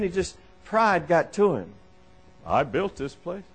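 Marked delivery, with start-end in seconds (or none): 2.4-3.4 low, softer boastful monarch; lightly voiced pompous king; proud king. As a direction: gloat quietly in a deep kingly voice.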